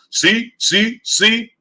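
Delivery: high, mocking sing-song "see, see"; taunting imitation of know-it-all commenters; mocking fans. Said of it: "people flooding my inbox talking about".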